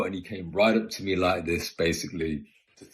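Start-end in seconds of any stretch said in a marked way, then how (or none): none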